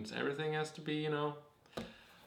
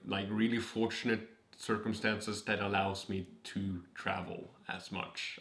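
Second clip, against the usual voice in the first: usual speaking voice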